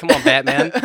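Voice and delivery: British Batman growl; gruff British hero voice. Acted. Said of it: "British Batman".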